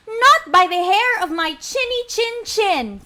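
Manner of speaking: high-pitched defiant piggy chant; little pig. Acted. Little pig answers the wolf, high sing-song refusal. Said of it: "what did the pig say".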